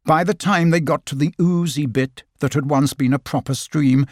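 usual speaking voice

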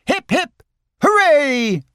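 high-pitched crowd cheer; cheering as a whole group would; cried cheer. A narrator's reading. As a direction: cheer it high, with a long hooray. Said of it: "cried the others".

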